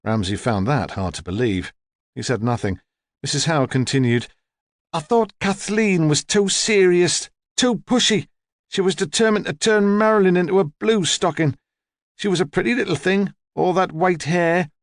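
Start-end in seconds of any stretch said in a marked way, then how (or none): 4.9-14.7 put-on high-pitched, disapproving old lady; opinionated old woman. Old woman criticising a mother to a detective, opinionated. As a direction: pass judgement in a high elderly voice.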